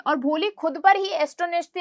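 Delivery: normal talking voice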